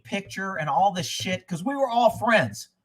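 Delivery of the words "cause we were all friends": ordinary talk, nothing marked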